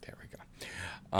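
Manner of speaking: breathy whisper to herself; raspy whisper. Real, not acted. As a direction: whisper it under your breath. Muttering to self while busy.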